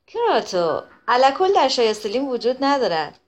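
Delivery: ordinary delivery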